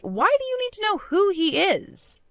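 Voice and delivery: high-pitched, agitated challenge; exasperated. Acted. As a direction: demand a reason, rattled. Wife challenged to name her boyfriend.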